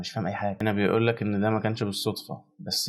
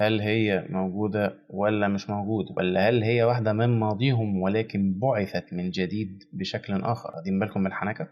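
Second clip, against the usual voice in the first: normal talking voice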